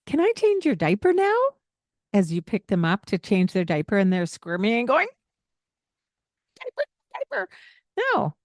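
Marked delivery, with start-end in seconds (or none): 0.1-1.4 high, sweet "Can I change your diaper now?"; sing-song asking. Shown as a demonstration. Parent asking baby about diaper, high sing-song question. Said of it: "Instead of". 6.6-8.3 mock baby protest. Parent voicing a squirming baby's protest, lightly done.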